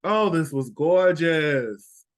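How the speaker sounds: ordinary delivery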